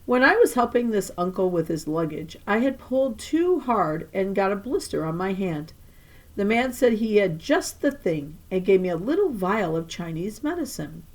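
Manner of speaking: ordinary delivery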